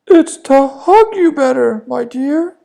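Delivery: very high, loud fake granny voice; sugary fake grandma; wolf as grandma. (the disguised wolf answering the girl, fake granny voice)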